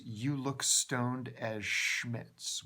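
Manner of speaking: "You look stoned as Schmitz" read very slowly, word by word; slow, deliberate. Shown as a demonstration. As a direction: lay out "Schmitz" slowly and carefully. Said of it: "The example of how they use that word".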